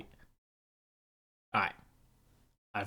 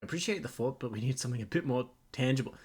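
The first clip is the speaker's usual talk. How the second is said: ordinary delivery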